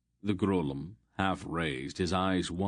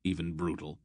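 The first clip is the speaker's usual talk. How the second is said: usual speaking voice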